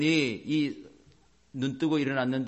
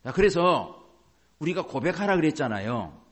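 normal talking voice

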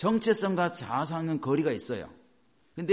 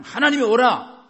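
loud, high cry calling on God; fervent cry; 9 semitones higher, 11 dB louder than usual. Acted. Preacher crying out to God before his congregation, loud and high. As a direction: call out to God with all your heart.

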